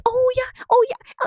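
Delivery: high, hammed-up "oh yeah"; mock gushing stranger. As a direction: squeal it like a fake-eager fan. Mocking overly eager strangers.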